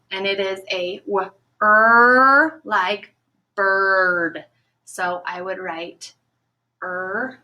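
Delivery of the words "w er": "w-, er" split apart, er held long and loud; "w-" then a long loud "er"; stretched sounds. Shown as a demonstration. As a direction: sound out "w-, er", leaning hard on er. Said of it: "Or is it a bird?".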